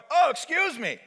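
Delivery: high, loud exclamation; acted flustered pardon; startled apology. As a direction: apologize as if walking in on someone. Acted embarrassed intruder.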